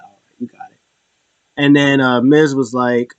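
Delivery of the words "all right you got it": hushed resigned mutter; quiet deadpan whisper; whispered aside. Retold or imitated. Triple H voiced quietly.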